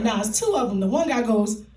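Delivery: ordinary talk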